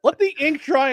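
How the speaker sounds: loud comic yell, falling away; exasperated shout. Acted. Host protesting the Joe Milton talk, exasperated shout. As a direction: throw it out as an exasperated holler. Repeated exasperated outburst at pundits.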